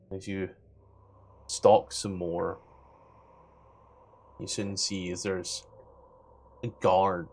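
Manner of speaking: soft, low, measured scene-setting; game master narration. Acted. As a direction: narrate a stealthy creep in a hushed, unhurried storyteller's voice.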